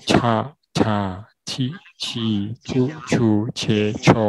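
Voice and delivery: very slow 叉叉七七啾啾切 切, syllable by syllable; syllable drill. Shown as a demonstration. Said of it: "第二".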